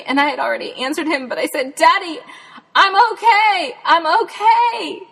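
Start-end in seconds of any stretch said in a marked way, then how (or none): 1.8-5.0 high, loud call from far off; shouting back. Retold or imitated. Stuck Jen answering her searching dad, shouting high and loud. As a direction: yell so he can hear you.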